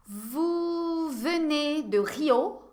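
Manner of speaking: "Vous venez de Rio?" crawling slowly, rising on "venez"; very slow model question. Shown as a demonstration. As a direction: stretch every syllable of the question. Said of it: "Je vais vous poser quelques questions". (pergunta muito lenta, subindo em "venez")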